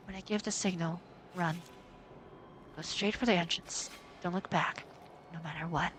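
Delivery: put-on hushed, urgent girl giving orders; quiet serious warning. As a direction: calmly tell her to run. Voices Mio's warning.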